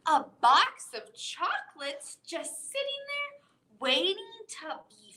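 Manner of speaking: put-on bright read-aloud voice for children; expressive story time reading; storybook reading. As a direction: read it like a picture book to little kids. Teacher reading picture book aloud.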